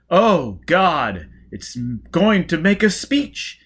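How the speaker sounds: loud, high, aghast exclamations; a horrified crowd member crying out; loud high appalled gasps and protests; shocked spectators. Acted. Spectators react to the speech.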